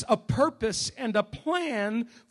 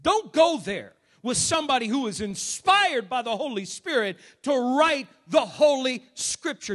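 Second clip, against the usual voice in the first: usual speaking voice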